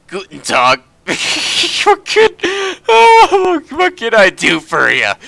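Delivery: high, loud, hammed-up old German tinkerer; gruff well-meaning German. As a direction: put on a thick, eccentric German accent. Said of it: "He speaks with a gruff but well-meaning tone".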